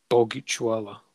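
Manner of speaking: ordinary delivery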